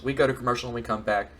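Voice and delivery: ordinary talk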